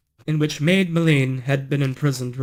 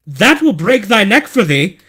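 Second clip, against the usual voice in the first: loud, higher angry threat; angry cry; 6 semitones higher, 6 dB louder than usual. Acted. False bride threatening the maid, furious and loud. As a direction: snap out the threat in a rage. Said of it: "cried the bride".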